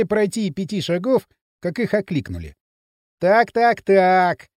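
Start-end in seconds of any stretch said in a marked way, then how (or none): 3.2-4.5 loud, raised, gloating taunt; mocking. A narrator's reading. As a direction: jeer at people you have just caught. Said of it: "раздался насмешливый, знакомый до отвращения голос". (громко и насмешливо)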